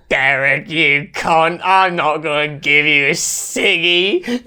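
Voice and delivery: drawn-out mock Aussie refusal; Australian bogan parody. Cartoon bogan refusing his mate a smoke, broad Australian accent. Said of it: "a parody of these bogans".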